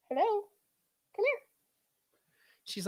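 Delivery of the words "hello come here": high rising hello to the dog; high sing-song hello for the dog; dog-talk hello. Real, not acted. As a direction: coo a high hello at the pet. Calling to the dog Bella.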